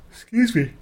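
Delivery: yawning mid-sentence. Real, not acted. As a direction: say it through a yawn. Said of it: "the moment we start recording, I need to yawn".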